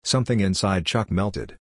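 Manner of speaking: plain reading voice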